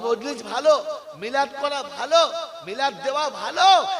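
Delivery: high, loud preaching call; fervent sermon delivery. Acted. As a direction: preach it out to the crowd. Preacher's raised opening.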